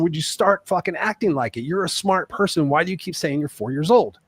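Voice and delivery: retold anger. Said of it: "One time I got mad at her".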